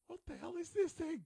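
high, loud burst of disbelief; baffled and outraged; incredulous outcry. Retold or imitated. Acting out a baffled outraged reaction.

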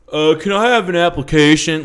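imitating an old man booming his question; way too loud. Old man in line asks the checker for an application, too loud. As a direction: bellow it like he wants the whole store to hear. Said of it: "way louder than he needed to be".

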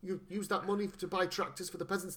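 ordinary delivery

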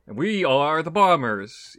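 big, loud show intro; excited intro. Acted. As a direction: announce it like a show opener.

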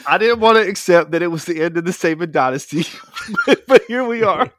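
reading through giggles; can't keep a straight face; laughing while reading. Real, not acted. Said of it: "he can't even keep a straight face".

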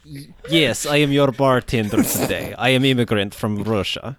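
thick Russian bartender; Russian accent. Acted. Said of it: "Das Vidanya".

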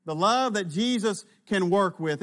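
ordinary talk, nothing marked

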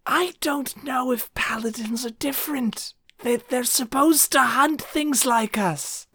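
high, hushed undead voice; whispering undead. Acted. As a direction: keep it secret and airy, a nervous undead. Undead character whispers worry.

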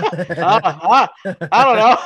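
loud, high reply through real laughter; laughing. Real, not acted. Storyteller replaying his clueless answer, laughing out loud. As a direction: crack up while answering.

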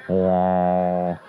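deadpan deep wow like a crowd; mock awed wow. Comic drops a deep booming wow.

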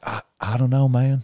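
lightly voiced Southern shrug; a hint of a Southern accent, modest; Southern drawl. Southern soldier shrugging off the girls, light Southern accent. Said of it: "He'd be like".

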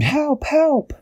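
very high-pitched cry for help; story-time scream, high but held back in volume; mock scream. A narrator's reading. Dashi almost inside the tree, high mock scream. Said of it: "She screamed".

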